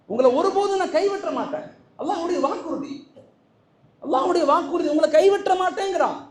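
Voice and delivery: high, loud promise ringing out; fervent proclamation. Acted. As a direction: declare the promise to the crowd.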